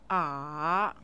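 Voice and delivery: "A" dipping low then rising; third tone. Shown as a demonstration. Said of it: "say each tone after me".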